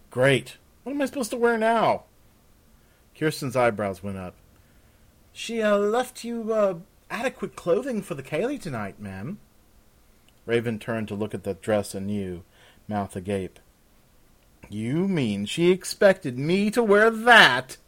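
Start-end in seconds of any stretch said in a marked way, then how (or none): 0.1-2.0 loud, rising to a high complaint; exasperated outburst. Acted. Exasperated guest without clothes. 5.3-9.4 put-on high, halting servant girl voice; hesitant maid. Nervous maid breaks awkward news. 14.7-17.8 slow, loud, high incredulous question; appalled disbelief. Acted. Guest appalled by the dress.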